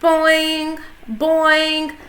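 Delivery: high, springy boing; springy cartoon boing noise; frog boing. A narrator's reading. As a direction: bounce the sound like a frog. Og's boing sound.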